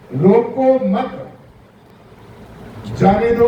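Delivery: slow रोको मत, long pause, then जाने दो; comma after मत. Shown as a demonstration. Preacher moving the comma to flip the meaning, pause after मत. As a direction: hold a long break between मत and जाने दो. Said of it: "और अगर उसी कोमे को हम".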